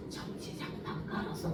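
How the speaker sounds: whispered secret; whispered reveal to a cold roommate. Retold or imitated. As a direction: breathe it out like a secret. Quiet reveal of heated mat.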